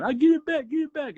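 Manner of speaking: hammed-up panicked shout to get it back; freaking out. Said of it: "he freaks out".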